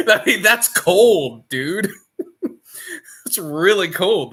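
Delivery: laughing. Real, not acted. Laughing reaction to the story.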